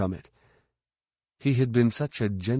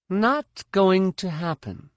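higher, slow flat refusal; young woman's voice; 10 semitones higher, 3 dB louder than usual. A narrator's reading. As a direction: refuse firmly in her voice. Jaina refuses.

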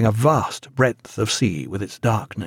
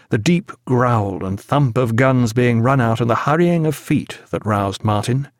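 ordinary delivery

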